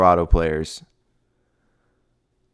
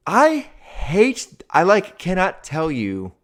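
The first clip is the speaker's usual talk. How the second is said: high, exasperated outburst; riled up; 7 semitones higher, slower than usual. Real, not acted. Host venting about Deion's move, exasperated. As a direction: blurt out the frustration. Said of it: "it gets me so riled up".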